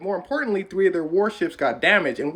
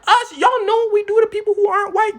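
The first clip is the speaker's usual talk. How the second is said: very high, loud defensive squeal; mock alarm; 6 dB louder than usual. Americans singled out about bowing, loudly defensive.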